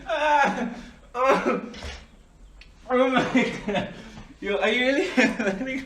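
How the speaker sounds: high, loud cracking up; can't stop laughing; laughing hard. Real, not acted. Cracking up at friend.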